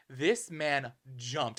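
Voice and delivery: ordinary talk, nothing marked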